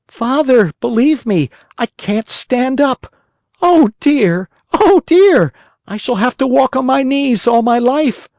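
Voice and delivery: crying oh dear from the floor; Pinocchio wailing. A narrator's reading. Puppet wails he can't stand.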